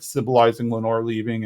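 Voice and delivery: ordinary talk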